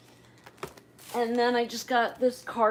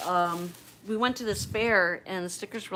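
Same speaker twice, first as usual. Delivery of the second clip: ordinary delivery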